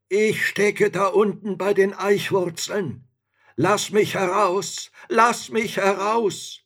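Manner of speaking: high, loud trapped-spirit call; voice from underground. A narrator's reading. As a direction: call up urgently from under the roots. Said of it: "als ob die Stimme unten aus der Erde hervorkäme".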